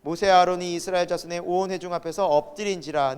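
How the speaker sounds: even, low-pitched Bible reading; recited sermon text; scripture reading. Acted. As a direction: read the verse aloud in a measured recitation. Reads the Bible passage aloud.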